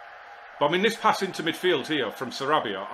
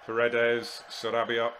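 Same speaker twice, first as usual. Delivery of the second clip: ordinary talk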